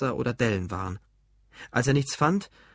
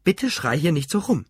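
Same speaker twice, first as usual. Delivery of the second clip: higher-pitched dismayed plea; appalled; 5 semitones higher, 4 dB louder than usual. A narrator's reading. Mother begging her son to stop shouting, appalled. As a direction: plead in shocked reproach. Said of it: "rief seine Mutter entsetzt".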